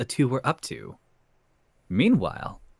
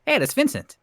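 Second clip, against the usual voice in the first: character voice; 14 semitones higher, 4 dB louder than usual. Acted. Character line read in fanfic.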